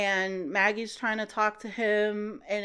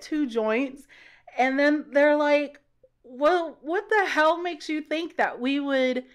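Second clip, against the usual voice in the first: incredulous; 4 semitones higher, 5 dB louder than usual. Retold or imitated. Officers incredulous at confession.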